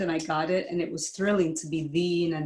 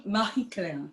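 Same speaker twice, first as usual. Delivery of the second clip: French ooh la la. Shown as a demonstration. Guest saying her name on request, with French flair. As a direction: purr Marie Claire the French way. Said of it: "say it with some essence".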